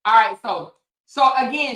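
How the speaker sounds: ordinary delivery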